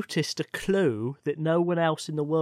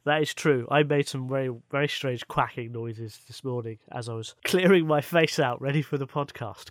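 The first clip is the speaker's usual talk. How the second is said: ordinary talk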